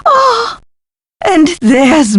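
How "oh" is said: very high, breath-caught exclamation; awed gasp. Acted. Professor looking through the peephole, awed high gasp. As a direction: let out a high gasp of wonder. Gasps at what peephole shows.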